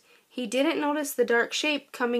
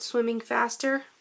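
usual speaking voice

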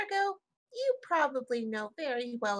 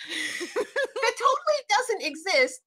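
laughing denial; 8 semitones higher, 8 dB louder, slower than usual. Acted. Tika denying, laughing.

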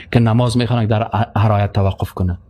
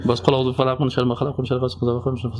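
rushed recitation; 3 semitones lower, 3 dB quieter, faster than usual. Shown as a demonstration.

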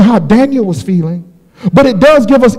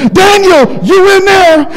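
anguished call; 7 semitones higher than usual. Acted. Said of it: "this is why I get happy".

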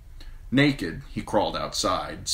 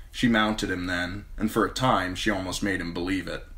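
usual speaking voice

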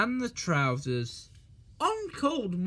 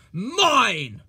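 loud cross snap; 3 semitones higher, 9 dB louder than usual. A narrator's reading. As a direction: claim it angrily. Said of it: "he said crossly".

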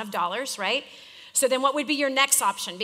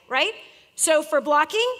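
normal talking voice

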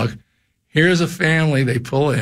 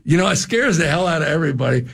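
ordinary talk

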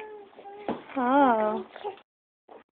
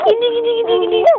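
sing-song; 8 semitones higher than usual. Real, not acted. Playing with the baby.

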